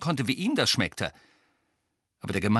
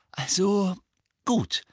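high, brittle, halting concession; 10 semitones higher, 3 dB louder, slower than usual. A narrator's reading. Mrs Weasley giving in to the others, brittle-voiced. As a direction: give in haltingly, voice close to cracking. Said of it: "sagte Misses Weasley mit brüchiger Stimme".

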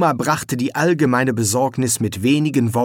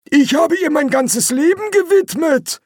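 put-on proud old man, much higher and louder; 4 dB louder than usual. A narrator's reading. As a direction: puff up with pride as the old man. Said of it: "erklärte der Alte stolz". (stolzer alter Mann, viel höher)

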